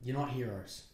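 low, soft, grave little lecture; hushed, low words of wisdom; solemn hush. Pretend. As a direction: drop right down and speak quietly. Mock-solemn final note to listeners.

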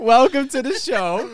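high, loud welcome; hammed-up host welcome; show intro. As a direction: announce it like a show opener. Podcast intro jingle voices.

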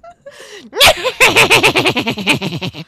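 loud high scary witch cackle; a creepy wicked-witch laugh; evil cackle. Shown as a demonstration. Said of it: "now I wanna try again".

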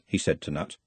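usual speaking voice

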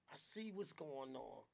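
normal talking voice

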